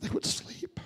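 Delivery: hushed whisper, voice dropped away. A narrator's reading. Preacher telling the orphans' story to the congregation, whispered. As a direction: breathe it like a bedtime hush.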